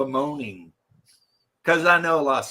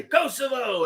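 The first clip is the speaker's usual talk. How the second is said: mock call-out; 9 semitones higher than usual. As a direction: toss it out high as bait. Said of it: "all you gotta do is say".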